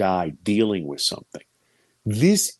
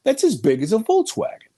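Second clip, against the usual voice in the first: dawning alarm; 13 semitones higher than usual. Retold or imitated. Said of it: "and then I went".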